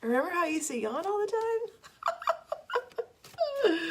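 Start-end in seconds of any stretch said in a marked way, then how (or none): none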